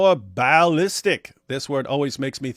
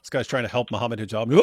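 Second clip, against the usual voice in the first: ordinary talk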